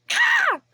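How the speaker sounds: sudden high loud yell of frustration; short angry shriek; frustrated scream. Real, not acted. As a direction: let out an exasperated shriek. Frustrated outburst.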